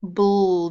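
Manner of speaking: "Bull" as the bl blend alone, drawn out; b and l run together in "Bull", no real vowel; isolated initial blend. Shown as a demonstration. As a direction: sound out "Bull" as a held b-l blend. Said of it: "It's the initial blend".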